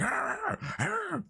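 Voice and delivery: high, drawn-out mock barks; imitated dog barking. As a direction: yap like a little dog. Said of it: "dog was just barking".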